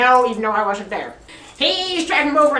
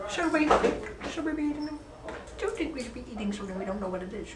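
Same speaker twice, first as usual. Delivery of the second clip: fading down to a far softer, quicker hush; hushed drunk pondering; 13 dB quieter, faster than usual. Retold or imitated. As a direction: drop to a conspiratorial near-hush. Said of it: "and we said".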